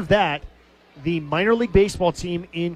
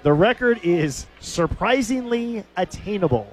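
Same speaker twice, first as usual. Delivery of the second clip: savoured repeat; 4 semitones higher than usual. Retold or imitated. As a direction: draw out "surprisingly attainable" with relish.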